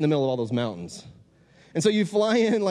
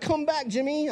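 much higher mock pleading voice; imitating North Korean fans; 11 semitones higher than usual. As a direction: put on a high-pitched adoring-fan voice. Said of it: "I don't know how they talk like that".

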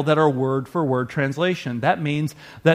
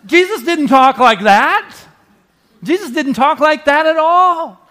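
laughing insistence; 11 semitones higher, 8 dB louder than usual. Real, not acted. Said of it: "preacher insists, laughing".

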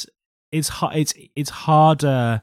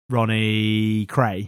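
Tom Hardy as Ronnie Kray; 2 semitones lower, much slower than usual. Retold or imitated. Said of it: "Tom Hardy doing Ronnie".

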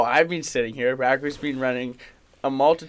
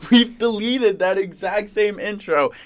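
exasperated; 7 semitones higher, 7 dB louder than usual. Real, not acted. Exasperated rant at cohost.

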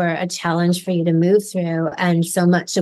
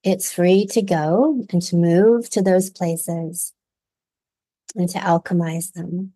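guided meditation. Acted. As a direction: gently guide listeners through the visualization. Leads a guided visualization.

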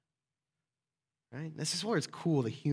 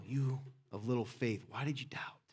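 not angry; 5 semitones lower, 7 dB quieter than usual. Shown as a demonstration. Preacher shows how Jesus spoke to Peter, gentle and unangry. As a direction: say it softly and tenderly, dropping away. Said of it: "he didn't say it angry".

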